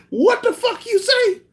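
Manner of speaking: very high incredulous squawk, half laughing; incredulous onlooker. Acted. Onlookers questioning what the boxer said, mock high disbelief. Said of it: "motherfuckers would look at Mike like".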